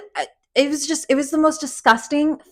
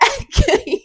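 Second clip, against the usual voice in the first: real laugh; 13 semitones higher than usual. Real, not acted. Laughs at the rescue.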